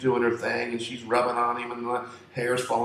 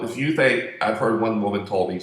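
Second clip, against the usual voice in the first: normal talking voice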